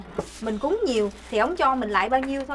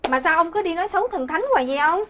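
raised, higher-pitched nagging; exasperated scolding; 4 semitones higher, 4 dB louder than usual. Acted. Wife telling husband off for badmouthing the gods, exasperated scolding. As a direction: scold him loudly for his irreverence.

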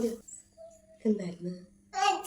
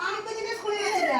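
much higher and softer, mimicking a whiny toddler; hammed-up childish whine, pitched way up; high whiny baby talk; 11 semitones higher, 8 dB quieter than usual. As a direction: talk like the sulking little one, high and soft. Mother soothing a crying child.

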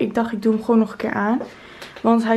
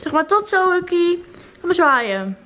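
pet talk; 7 semitones higher than usual. Real, not acted. Saying goodbye to the pet.

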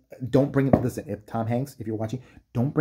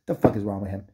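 usual speaking voice

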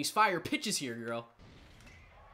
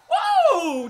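big, high, loud shout of amazement; excited whoa; 13 dB louder than usual. Real, not acted. Gamer watching a home run, shouting in amazement. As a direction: let it explode out of you.

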